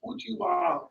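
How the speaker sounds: very high, soft squeal; giddy I-told-you-so. Retold or imitated. New rep repeating the win to mom, giddy. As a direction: squeak with delighted vindication.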